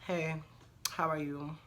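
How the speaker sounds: light, easy hello; breezy and unbothered; casual friendly greeting. Retold or imitated. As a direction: keep it sweet and casual.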